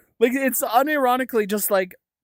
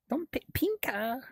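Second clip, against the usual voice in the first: high, soft, half-whispered "pinka"; tiny whispery "pinka"; hushed playful word; 4 semitones higher, 9 dB quieter than usual. Pretend. Playing with the word pink.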